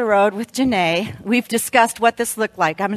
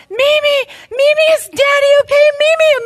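put-on high, loud frantic child's voice; much higher, louder little-kid pleading; crying child; 5 dB louder than usual. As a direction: voice the panicked kids pleading. Kids voiced crying in car.

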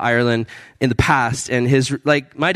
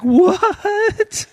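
very high, stretched squeal of disbelief; hammed-up incredulous shriek; can't believe your eyes; astonished; 3 dB louder than usual. Retelling his shock at the tattoos.

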